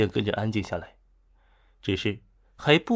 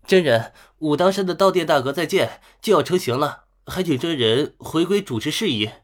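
raised voice, calling up to the master; loud call; 4 semitones higher, 4 dB louder than usual. Acted. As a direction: call out loudly up the mountain. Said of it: "大声道".

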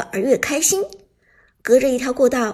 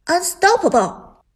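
booming announcer call; game announcer; 6 semitones higher, 5 dB louder than usual. Acted. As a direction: hype kill announcer voice.

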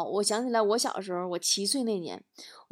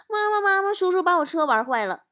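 put-on high, anxious childish tattling; nervous little boy; 8 semitones higher, 3 dB louder than usual. Child afraid of a scolding tells mum on uncle, nervous. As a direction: play a kid hurrying to shift the blame. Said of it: "特别紧张".

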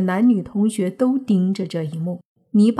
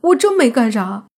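high, loud, exasperated denial; miserable, wronged protest; 6 semitones higher, 5 dB louder than usual. A narrator's reading. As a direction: protest your innocence in anguished disbelief. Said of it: "泥巴苦着脸说道". (又急又委屈地高声喊冤)

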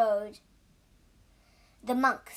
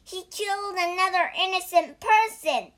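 high, loud accusation; raised voice, denouncing someone; accusing cry; 7 semitones higher, 5 dB louder than usual. A narrator's reading. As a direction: cry out in outrage. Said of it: "cried the pig".

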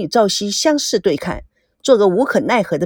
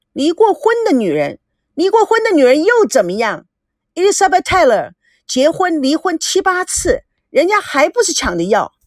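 higher-pitched indignant comeback; defiant retort; 6 semitones higher than usual. A narrator's reading. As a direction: throw the insult back at them. Acted quarrel, defiant retort. (不服气地提高嗓音反驳)

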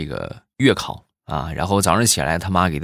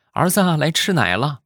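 lightly voiced mother, pitched higher; mother calling; 8 semitones higher than usual. Mother calling little Xiaoming to nurse, light high voice. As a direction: call the child sweetly to come eat.

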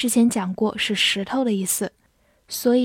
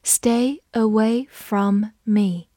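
slow, stretched-out reading; slower than usual. Shown as a demonstration. Teacher reading the sentence slowly. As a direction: read it slowly for learners. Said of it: "我们慢读一遍".

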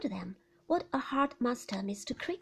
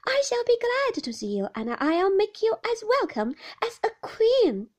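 put-on high, loud boyish welcome; high-pitched, excited small boy; eager boy; 11 semitones higher, 10 dB louder than usual. A narrator's reading. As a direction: promise it with childlike eagerness. Boy Davy welcomes Peggotty eagerly.

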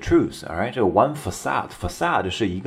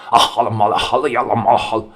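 harsh Arabic imitation; 3 semitones higher, 4 dB louder, faster than usual. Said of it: "比较harsh".

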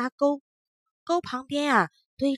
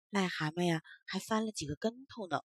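ordinary delivery

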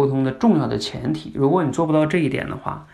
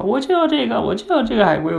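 put-on much higher, insistent child's whine; whiny little girl; 10 semitones higher than usual. Daughter refusing a new turtle, high childish insistence. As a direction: play the small girl demanding her turtle back.

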